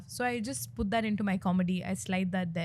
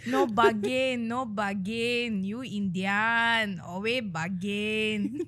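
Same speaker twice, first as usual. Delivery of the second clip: slow, stretched Thai-accented haggling; much slower than usual. Shown as a demonstration. Comedian plays a vendor refusing an Indian bargainer, drawn-out Thai accent. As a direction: drag out each phrase in a mock Thai accent. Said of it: "What is Thailand accent?".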